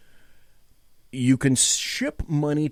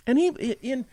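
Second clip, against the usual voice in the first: usual speaking voice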